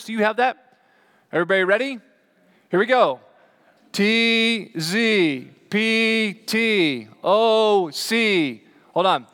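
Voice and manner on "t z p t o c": slow, raised, drawn-out letters; calling out letters. Shown as a demonstration. Preacher testing the congregation's eyes on a chart, calling out slowly. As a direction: call each letter out separately, high and long.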